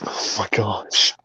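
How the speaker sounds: slow breathy whisper of disbelief. Real, not acted. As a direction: whisper it slowly in shock. Repeat of reaction, whispered.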